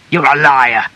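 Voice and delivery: loud, forceful accusation; first furious burst; angry shout. A narrator's reading. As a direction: shout it as an enraged husband. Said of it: "he shouted".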